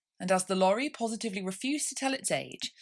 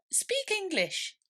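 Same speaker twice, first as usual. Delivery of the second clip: very high, slow cheeky retort; a young bird mocking big words; blunt eaglet; 14 semitones higher, much slower than usual. A narrator's reading.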